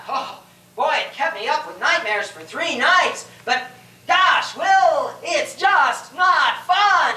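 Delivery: gush, then pronounce it dull; mock critic. Mock critic addressing Shakespeare.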